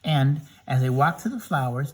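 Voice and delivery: ordinary delivery, nothing marked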